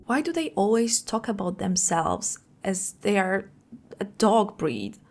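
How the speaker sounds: normal talking voice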